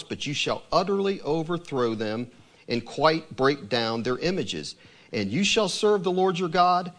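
measured, solemn reading of scripture; steady, reverent Bible reading. Acted. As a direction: read it like holy writ, deliberate. Reads scripture passage aloud.

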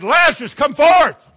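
Lazarus, come forth shouted high and loud; booming command. Shown as a demonstration. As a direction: call him out of the tomb at full voice. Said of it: "he yelled loud enough".

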